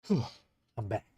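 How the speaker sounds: breathless after rushing back; puffing between words; out of breath. Real, not acted. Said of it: "I'm out of breath".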